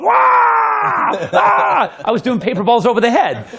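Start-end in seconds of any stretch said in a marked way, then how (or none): 0.0-2.0 high, loud imitated crowd yell; mimicked cheering crowd, high and loud; crowd shouting. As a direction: yell like a wowed bar crowd. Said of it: "he heard people going".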